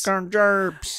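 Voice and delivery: high, slurred mangling of the words; mock catchphrase outcry. Co-host mocking fears of robots taking jobs, high garbled outcry. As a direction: squawk it as a garbled mock complaint.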